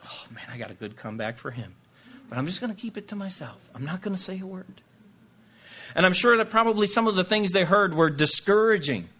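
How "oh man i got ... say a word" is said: soft, quick aside to himself; mock muttered aside. Marcher biting back a comeback, quiet aside. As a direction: keep the comeback under your breath. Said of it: "I'd be going".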